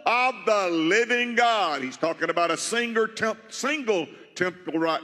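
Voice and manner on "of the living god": high, ringing preacher's cadence; fervent preaching. Real, not acted. Pastor answering his own question to the congregation, ringing preaching. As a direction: declare it over the church.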